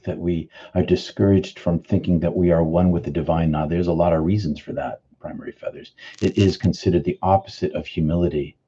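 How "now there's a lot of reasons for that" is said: ordinary talk, nothing marked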